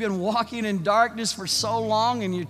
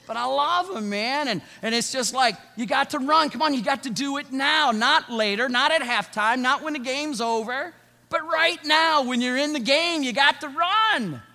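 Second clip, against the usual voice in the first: higher, louder coaching yell; sideline coach; 7 semitones higher, 6 dB louder than usual. Retold or imitated. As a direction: fire up little kids to run. Coach shouting at kids.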